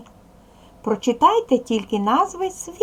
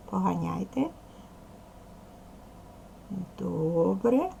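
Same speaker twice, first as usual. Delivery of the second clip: normal talking voice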